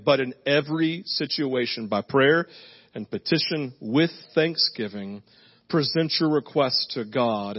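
slow, soft, reverent reading; scripture reading. Acted. Pastor reading Philippians four to the congregation, slow and reverent. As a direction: read it like a blessing over the room.

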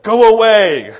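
loud mock shout of listen, go away; the disciples yelling at the families. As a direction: shoo them off with a loud bark. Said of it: "He's saying".

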